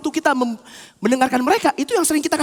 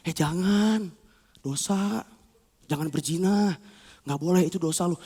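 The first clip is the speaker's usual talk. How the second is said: low, soft, drawn-out caution; gentle warning; 7 semitones lower, 7 dB quieter than usual. Acted. Conscience warning the soul, low and gentle. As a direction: softly hold someone back.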